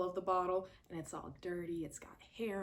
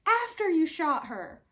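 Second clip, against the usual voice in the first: high, loud disbelief; scolding the character; outraged exclamation; 10 semitones higher, 11 dB louder than usual. Retold or imitated. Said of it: "And it's like".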